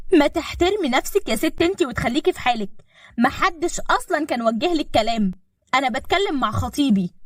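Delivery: high-pitched, offended comeback; indignant retort. A narrator's reading. Fiancée telling his mother to stay out, indignant.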